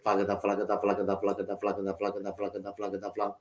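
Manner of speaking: flagada drum pattern, low, fading very soft; unaccented rhythm imitation. Shown as a demonstration. Drummer demonstrating ghost notes for students, soft low patter. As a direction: patter evenly and let it sink away. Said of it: "No accents".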